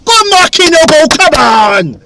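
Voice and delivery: high, loud roar of encouragement; cheering shout. Real, not acted. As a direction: yell the player on. Urging player on.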